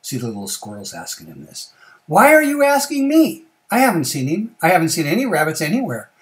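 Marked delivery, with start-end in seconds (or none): 2.1-6.1 loud, high, indignant protest; defensive bear. Acted. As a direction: protest too much, loud and emphatic. Guilty bear echoes rabbit's protest.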